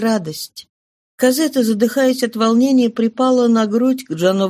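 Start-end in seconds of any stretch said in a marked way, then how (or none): none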